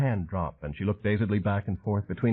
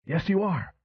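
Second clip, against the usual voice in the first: yelped; 10 semitones higher, slower than usual. A narrator's reading. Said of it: "Flint yelped".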